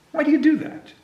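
high little mouse voice, curious; squeaky field mouse. Acted. Librarian voicing the field mouse asking Carl, high and curious. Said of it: "asked a field mouse".

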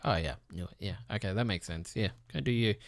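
low, soft, deadpan shrug; bored low mumble of someone not surprised; unimpressed. Retold or imitated. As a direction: brush it off like you saw it coming, low and quiet. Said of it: "I'll be like".

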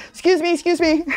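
high, loud "excuse me" calls; calling after them. Retold or imitated. Chasing tourists to confront them.

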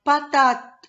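high, loud "patate"; teacher revealing the word to children, triumphantly. Shown as a demonstration. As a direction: burst out "patate" like a prize. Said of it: "j'aurai le mot".

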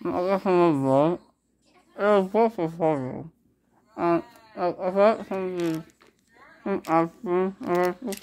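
talking around gauze pads; muffled after tooth extraction; mouth stuffed with gauze. Real, not acted.

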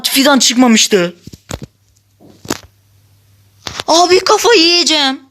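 high-pitched, fed-up complaining; exasperated outburst. Real, not acted. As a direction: pitch up in disbelief at the game. Frustrated over replaying one block.

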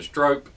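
"strope" said northern-style, with a long O; northern pronunciation. Shown as a demonstration. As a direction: say "strope" to rhyme with rope. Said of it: "from the north and you pronounce it".

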